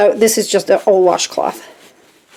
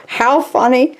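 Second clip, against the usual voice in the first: ordinary talk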